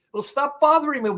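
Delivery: high-pitched, fed-up brush-off; exasperated retort. Acted. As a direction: push away a nagging voice. Acted retort to nagging voice.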